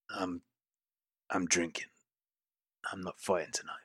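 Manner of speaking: quietly turning them down, weary and a little sad; sad refusal. Acted. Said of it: "there's a sadness almost to his response".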